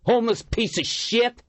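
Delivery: high, spat-out insult; angry insult. A narrator's reading. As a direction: spit the words at him. Heckler insulting homeless leader.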